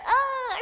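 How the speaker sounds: high, mock-pained groan through laughter; acted-out yelp of discomfort; retold "ugh". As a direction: imitate a stung "ugh", half laughing. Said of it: "And I was like".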